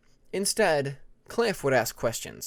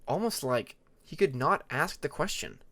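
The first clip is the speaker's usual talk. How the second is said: ordinary delivery, nothing marked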